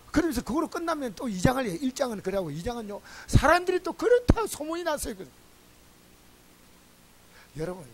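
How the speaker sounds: ordinary talk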